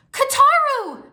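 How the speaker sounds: long, very high, loud call; joyful cry. A narrator's reading. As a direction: cry out to a long-lost brother. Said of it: "she cried".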